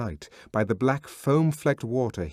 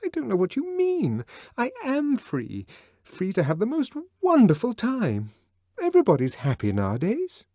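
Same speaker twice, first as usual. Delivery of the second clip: much higher, brightly defensive; Lenina insisting; 13 semitones higher, 4 dB louder than usual. A narrator's reading. Lenina defending herself to Bernard, much higher. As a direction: chirp it, insisting.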